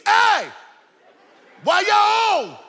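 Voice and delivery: very high, loud holler; child hollering from the back seat, acted out at full voice; retold kid's yell. Boy in the back seat yelling to his parents. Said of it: "I just yelled to the front seat".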